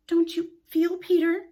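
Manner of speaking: a touch of hesitant faltering. Acted. Wendy to Peter, shyly faltering. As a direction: falter over the words. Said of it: "she said falteringly".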